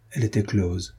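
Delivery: ordinary delivery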